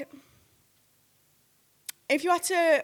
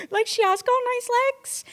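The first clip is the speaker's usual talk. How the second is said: amused retort; 14 semitones higher, 5 dB louder than usual. Retold or imitated. Laughing retort to fan's outrage.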